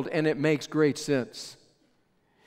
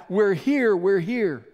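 raised answering call, a touch higher; crying out; 3 semitones higher, 4 dB louder than usual. Retold or imitated. Trapped workers calling back to their rescuer, raised mock call. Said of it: "they began to cry out".

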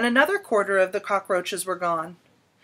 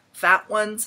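ordinary delivery, nothing marked

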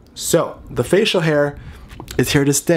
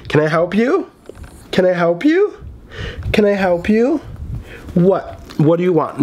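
sassy to the dog; 6 semitones higher than usual. Real, not acted. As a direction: tease the pestering pet. Sassing the dog Tinkerbell.

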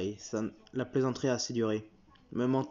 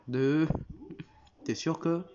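usual speaking voice

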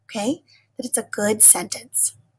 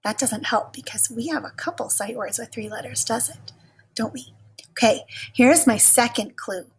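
normal talking voice

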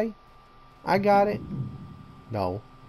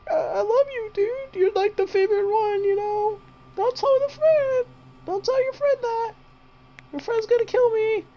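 high, pleading falsetto with a crying whimper; pleading sweet-talk. Pretend. Gamer begging the monster not to kill him, high whiny plea.

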